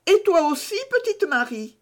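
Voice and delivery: voix d'enfant bien plus aiguë et plus forte; l'enfant demande vivement; petit garçon curieux. A narrator's reading. Pierre interroge Marie. (petit garçon curieux, voix d'enfant aiguë)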